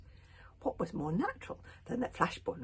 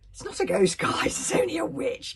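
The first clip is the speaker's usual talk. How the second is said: relieved yell; 2 semitones higher, 13 dB louder than usual. A narrator's reading. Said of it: "yelled out Mogadon the Meathead in relief".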